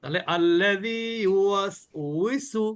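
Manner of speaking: very slow ইউওয়াসউইসু with a rounded, lip-spread উই; teacher models the correct reading for students; correct recitation. Shown as a demonstration. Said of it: "সবাই বলি।".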